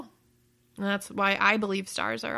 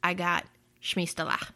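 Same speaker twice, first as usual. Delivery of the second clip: put-on light Russian accent; fake Russian accent; 3 semitones lower than usual. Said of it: "in my fake Russian accent".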